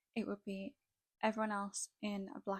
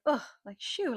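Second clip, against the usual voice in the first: imitating a haughty brush-off, pitched up on shoo; dismissive shoo; 5 semitones higher, 8 dB louder than usual. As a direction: wave them off with a scornful shoo. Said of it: "shooed it away and was like".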